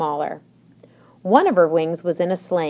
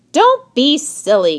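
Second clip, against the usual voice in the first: higher, louder scolding cry; mock cry; 9 semitones higher, 7 dB louder than usual. A narrator's reading. Tilly brushes off Mona's wish, crying out. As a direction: cry it out dismissively. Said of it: "cried Tilly".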